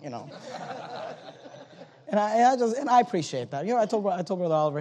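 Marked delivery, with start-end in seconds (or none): 0.3-1.5 breathy laugh. Real, not acted. Laughs at own story.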